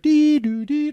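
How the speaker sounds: high sung beep-boop tones imitating a radio alarm; mock alert beeps. Telling friends about the car radio alert, high mock beeps. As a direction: chirp it like an emergency radio tone.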